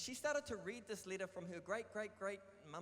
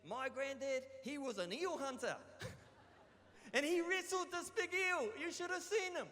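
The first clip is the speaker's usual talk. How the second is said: high, louder proud little grandkid voice; boasting grandchild; 7 semitones higher, 5 dB louder than usual. Retold or imitated. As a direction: brag about granddad like a kid. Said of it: "my grandchildren will say".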